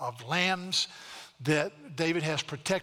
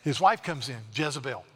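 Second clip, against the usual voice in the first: normal talking voice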